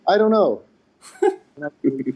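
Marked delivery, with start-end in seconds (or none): 0.1-0.6 mock clueless shrug. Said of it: "And he's like".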